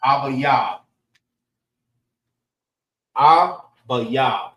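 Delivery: "Abayab" said AH-buh-yah, first syllable stressed, ending falling; teacher demonstrating "Abayab" as ah-buh-yah; Hebrew pronunciation. Shown as a demonstration. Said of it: "That's how you say Abib in the Hebrew".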